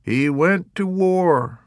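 high, slow knowing remark; surmising pilot. A narrator's reading. Fighter pilot explaining the death, slow and knowing. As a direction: pronounce it wisely.

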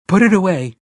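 high, loud alarmed warning; urgent frightened plea; alarmed cry. A narrator's reading. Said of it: "Mia cried, alarmed".

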